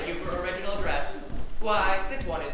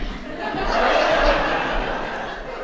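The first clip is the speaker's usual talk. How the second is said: loud breathy laugh; 8 dB louder than usual. Real, not acted. Announcer laughs at not caring about designers, loud. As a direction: laugh off your own cluelessness.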